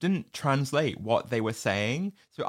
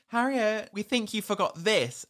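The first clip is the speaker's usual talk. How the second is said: sing-song taunt; 9 semitones higher than usual. Retold or imitated. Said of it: "pop their heads out the window and go".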